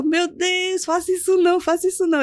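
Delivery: high, pleading cry of panic; desperate pleading. Retold or imitated. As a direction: beg him to stop, voice way up. She begs nurse not to inject.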